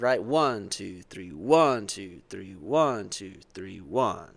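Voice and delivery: cyclical count, heavy one and faint two three; strong one, fading two three. Shown as a demonstration. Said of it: "this cyclical motion of".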